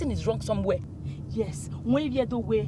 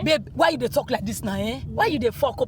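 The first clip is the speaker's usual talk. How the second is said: ordinary talk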